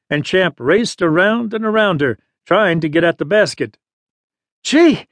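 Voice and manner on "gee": panted exclamation. A narrator's reading. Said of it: "Juno panted".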